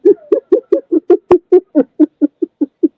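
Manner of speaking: long delighted laugh; happy laugh. Real, not acted. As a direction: laugh out in joy. Story climax, lo and behold.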